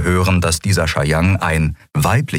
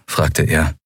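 plain reading voice

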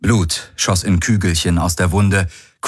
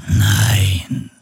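ghostly whisper. A narrator's reading. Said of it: "wisperte eine Geisterstimme".

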